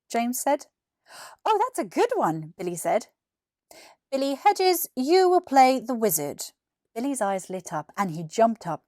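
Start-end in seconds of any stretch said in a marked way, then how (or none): 1.4-2.5 put-on higher, admiring kid voice; impressed boy. Billy praising James's part, lightly boyish. 4.1-6.5 slightly louder, formal teacher announcement; announcing teacher. Acted. Miss Willis reading casting to the row, announcing.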